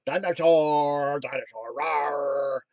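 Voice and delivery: slow, low, drawn-out mock roar; imitating a costumed dinosaur. Speaker imitating cat in dinosaur costume, slow goofy roar. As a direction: stretch each word into a goofy dinosaur roar. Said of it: "costume on a cat and goes around".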